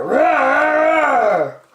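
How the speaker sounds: loud, high, hammed-up roar; cranky bear roar. The cranky bear roars at the friends, loud. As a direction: roar to chase them off. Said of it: "went the cranky bear".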